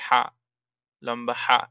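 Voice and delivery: হা with a rushing breathy sound from the throat; throaty ha. Shown as a demonstration. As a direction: let হা hiss out of the throat. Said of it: "গলায় শো শো করে শব্দ হবে". (গলা থেকে শো শো শব্দে হা)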